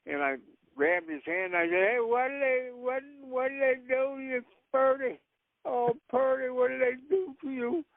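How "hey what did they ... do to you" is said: crying. Acted. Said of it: "And I'm crying".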